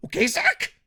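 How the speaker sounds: loud, very high rising question; scolding yell. Acted. Reviewer scolding Zack Sabre Junior, scolding yell.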